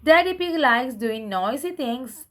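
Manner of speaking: ordinary delivery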